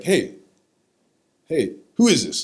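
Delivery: high, loud imitated grandfather on the phone; retold phone shout. Grandfather answering grandson's phone call, loud retold voice.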